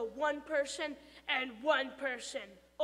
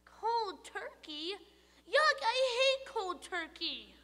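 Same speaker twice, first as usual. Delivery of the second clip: high, slow disgusted squeak; the tooth recoiling; 8 semitones higher, 4 dB louder, slower than usual. Acted.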